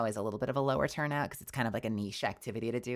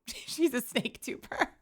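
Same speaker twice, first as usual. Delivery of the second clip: laughing; slower than usual. Real, not acted. Giggly joke about snake vtuber.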